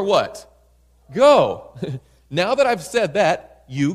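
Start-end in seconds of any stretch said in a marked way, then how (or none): 1.1-1.7 one loud, sharp command; commanding shout. Acted. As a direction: bark it as God's order. God's command in sermon.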